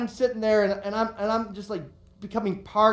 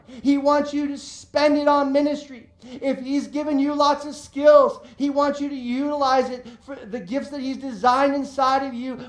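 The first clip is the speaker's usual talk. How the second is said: higher, louder preacher's cadence; fervent preaching; 6 semitones higher, 4 dB louder than usual. Acted. Pastor urging the congregation to share, fervently preaching. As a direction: preach it with building fervor.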